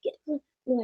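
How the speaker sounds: lightly voiced inner crackhead egging her on to buy. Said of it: "My voice sounds like a New Jack City crackhead".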